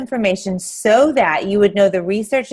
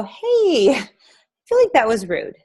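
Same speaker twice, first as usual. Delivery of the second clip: mock polite complaint; 5 semitones higher than usual. Confronting a smoker.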